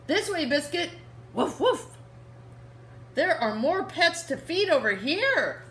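put-on bright little-girl character read to kids. Reading Biscuit to children, the little girl cheerfully calls her puppy.

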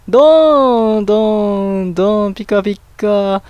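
long drawn-out spoken boom noises, first one high and loud; loud boom easing into a chant; imitated booms. Real, not acted. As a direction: voice the crashes along with the game. Voicing booms and flashes.

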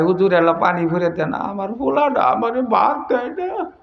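soft mock-weeping plaint, rising at the end; hammed-up quiet whimpering; tearful mother's complaint. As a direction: imitate a mother tearfully pleading with the cleric. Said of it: "কান্দে না?".

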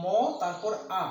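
"ম" টেনে, তারপর আলাদা "আ"; "ম" আর "আ" আলাদা করে শোনাও; ধ্বনি ভেঙে উচ্চারণ. Shown as a demonstration. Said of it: "যখন মাকে আমরা উচ্চারণ করি". ("ম, তারপর আ" ভেঙে টেনে বলা)

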